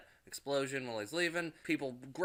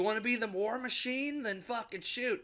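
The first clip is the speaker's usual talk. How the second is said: high taunting challenge; Tony taunting; 10 semitones higher than usual. Retold or imitated. Tony dares Rhodey to shoot, high and taunting. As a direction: dare him to shoot, pitched up. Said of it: "Tony says".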